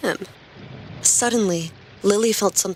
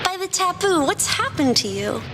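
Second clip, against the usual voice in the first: put-on worried girl voice; 11 semitones higher than usual. A narrator's reading. Girl alarmed at drenched friend.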